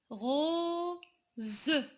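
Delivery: "Rose" drawn out as RO, pause, ZE; split into sounds. Shown as a demonstration. Teacher giving pupils an example, "Rose" split into sounds. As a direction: stretch "Rose" in two parts with a buzzing z. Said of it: "Pourquoi on a dit Z".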